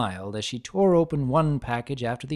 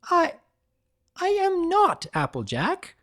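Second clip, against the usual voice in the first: flustered. A narrator's reading. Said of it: "Rarity flushed".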